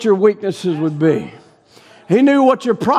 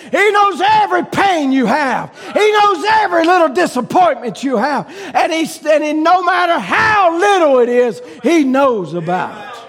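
full-force preaching; 4 semitones higher than usual. Acted. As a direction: preach it out to the congregation.

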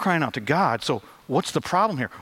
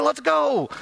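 mock cheerleader; 11 semitones higher than usual. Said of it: "Shouldn't you be like".

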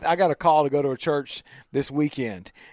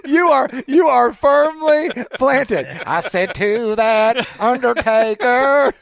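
laughing; 7 semitones higher, 5 dB louder, slower than usual. Real, not acted. Laughing joke about Undertaker.

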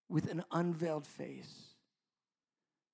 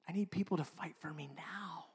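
whisper; 8 dB quieter than usual. Real, not acted. Pastor pleading softly with his congregation, whispered. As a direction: breathe it out as a secret plea. Confession in sermon.

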